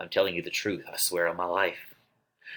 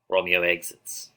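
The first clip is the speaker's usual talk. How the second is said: ordinary delivery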